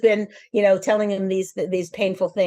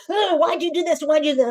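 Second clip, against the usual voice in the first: high, sharp mock yell at the kids; a hint of a frazzled mom yelling; mock screaming at kids; 7 semitones higher than usual. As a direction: imitate a mother snapping at her children, pitched up. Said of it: "I scream every day".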